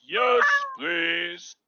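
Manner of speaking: usual speaking voice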